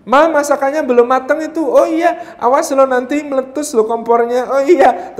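high-pitched put-on alarm; acted family ruse. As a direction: imitate a parent being urgently reminded of the stove. Acted trick exchange to slip away.

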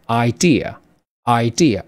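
idea said slowly as eye-DEE-uh, twice; teacher's slow model reading. Shown as a demonstration. Teacher reads idea slowly to the class, clear model pronunciation. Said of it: "第二个读作idea".

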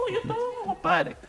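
comic squeal of protest, very high; high-pitched refusal. Retold or imitated. Friend refusing to go near Wilmer, high-pitched protest.

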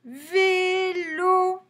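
Vélo high and loud, both vowels held long; drawn-out syllables. Shown as a demonstration. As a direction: sing out Vélo on sustained notes. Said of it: "Deux syllabes".

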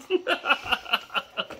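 an unvoiced, airy giggle; a soft wheezing laugh; breathy laugh. Real, not acted. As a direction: laugh it off under your breath. Laugh after shout.

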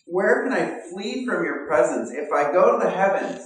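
high, loud, proclaiming the psalm; fervent psalm reading. Acted. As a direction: call out the psalm with rising fervor. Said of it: "It says".

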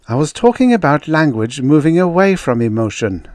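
high, keen scientist making her case; earnest woman researcher. Acted. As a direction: put your case to sceptics.

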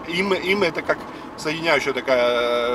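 normal talking voice

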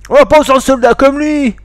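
high, loud, drawn-out jeer; indignant heckle. Acted. Onlooker protests at the accused soldier, loud heckle. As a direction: call it out over the courtroom. Heckler in acted courtroom interrogation.

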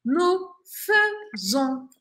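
"Nous faisons" with fai as a short fe, nu-fe-zon; Spanish: "Nous faisons" pronunciado fesón, lento; slow spoken form. Shown as a demonstration. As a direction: stretch it slowly, faisons like fezon. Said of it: "Pero se escribe faisons. Pero lo digo faisons".

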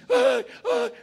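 high, loud "ay" yelps; imitated cries. Student suddenly crying out during field work, imitated high yelps. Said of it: "y empieza a gritar".

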